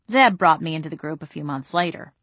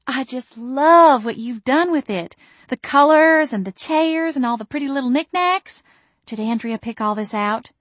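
cooing mother; 7 semitones higher, 4 dB louder than usual. A narrator's reading. Mother gushing over the shop's decor, high coo. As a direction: gush over every knickknack.

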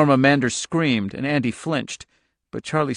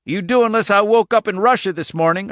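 raised, louder, defiant retort; defiant farmer; 8 semitones higher, 5 dB louder than usual. A narrator's reading. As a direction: stand your ground against the intruders. Irv defies men at his door.

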